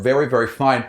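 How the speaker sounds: ordinary talk, nothing marked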